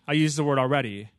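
ordinary talk, nothing marked

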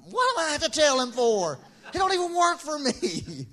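high, loud, laughing disbelief; incredulous protest. Retold or imitated. Speaker protesting to bosses about firing someone, incredulous. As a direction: protest the unfair job.

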